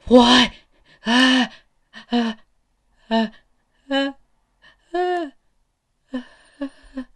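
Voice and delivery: high loud scream breaking into repeated cries. Acted. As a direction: scream in horror, gasping out each cry. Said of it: "And Izuku just screams out".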